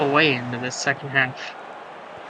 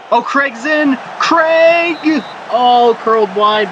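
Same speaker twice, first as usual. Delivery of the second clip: much higher, louder yell of "Craig!", then a slower groan as it goes wide; excited shout; 6 semitones higher, 4 dB louder, slower than usual. Real, not acted. Commentator roaring as the striker breaks through, then deflating. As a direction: scream the chance then sag at the miss.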